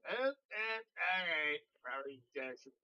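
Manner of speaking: slow, hesitant, taken-aback reply; nervous backing off. Retold or imitated. The others awkwardly accepting the threat, slow and uneasy. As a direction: back away uneasily.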